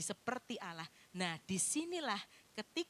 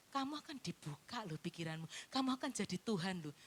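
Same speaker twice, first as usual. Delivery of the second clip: much softer, coaxing serpent; soft enticing whisper-like; 5 semitones lower, 5 dB quieter than usual. Acted. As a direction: lure her in gently.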